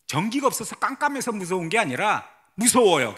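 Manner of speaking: ordinary delivery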